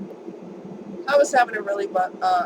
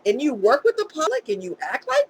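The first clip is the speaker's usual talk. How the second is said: higher, louder outraged complaint; indignant rant; 6 semitones higher, 5 dB louder than usual. Real, not acted. Complaining to viewers about rude medics, indignant. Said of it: "Attitude from here to Egypt".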